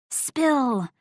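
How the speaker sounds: high, insistent one-word order; demanding. A narrator's reading. Friend orders the secret out, demanding. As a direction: demand the gossip at once. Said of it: "Nat pressed her hands onto the table".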